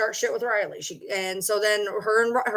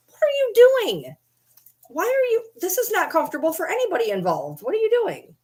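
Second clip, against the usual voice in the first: pet talk; 9 semitones higher than usual. Real, not acted. Scolding the wriggling dog in high pet voice. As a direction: fuss over the dog sweetly.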